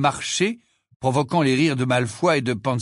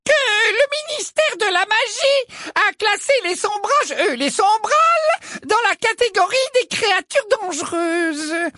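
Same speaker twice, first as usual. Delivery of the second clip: Umbridge trumpeting. A narrator's reading. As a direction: announce the danger with sweet menace. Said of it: "d'une voix claironnante".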